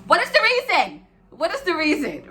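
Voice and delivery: loud, high-pitched exasperated repeat; exasperated demand. Retold or imitated. Retelling confronting the guy, loud exasperated demand. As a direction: throw the question at him, fed up.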